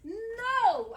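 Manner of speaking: loud, cutting no; sharp shout. Acted. Wife to husband who reaches for her, sharp shout. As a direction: stop him dead with a shout.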